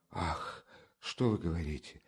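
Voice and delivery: very soft, dismayed gasp of words; barely voiced alarm; horrified hush. Mother hearing the friend's warning, hushed horror.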